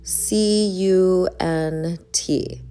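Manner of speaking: slow, deliberate letter-by-letter spelling; spelled out slowly. Shown as a demonstration. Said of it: "here's another cuss word".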